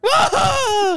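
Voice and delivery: put-on high-pitched panicked kid scream; kid's shriek. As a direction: scream like a kid losing his toy. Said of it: "he's like".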